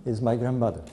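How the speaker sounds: ordinary delivery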